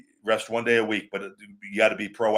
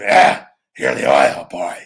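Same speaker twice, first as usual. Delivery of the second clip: low, loud, slow growl of a boss; Vince; 9 semitones lower, 9 dB louder, slower than usual. Pretend. Boss greeting the oil boy at headquarters, mock gruff roar. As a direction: put on a big, booming low voice and stretch it out. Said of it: "Maybe Vince".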